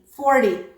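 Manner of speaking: "Forty" as for-dee, first syllable stressed; flapped t. Shown as a demonstration. Teacher contrasting two words, "Forty" with a flapped t. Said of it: "t changes into the alveolar flap".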